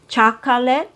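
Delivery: "chocolate" as CHAH-kah-let, rising at the end; three-syllable chocolate. Shown as a demonstration. Teacher listing non-native versions, "chocolate" as CHAH-kah-let. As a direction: give "chocolate" three full beats. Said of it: "you're not gonna hear native speakers say".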